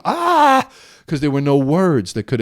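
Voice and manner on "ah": a sudden piercing high scream of pain; anguished scream. Retold or imitated. Alone in the backyard, screaming out old pain: high anguished scream. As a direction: scream out the hurt with no words. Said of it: "I just started to scream".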